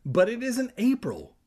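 put-on high-pitched boy's declaration; declaring. A narrator's reading. Kermit corrects the prankster, declaring in a high voice. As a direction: pitch it up as a literal-minded boy. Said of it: "Kermit declared".

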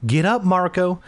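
put-on a mother insisting her kid obey; stern mother. A narrator's reading. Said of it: "she repeated sternly".

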